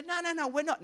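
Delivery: indignant denial. Retold or imitated. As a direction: push back, offended.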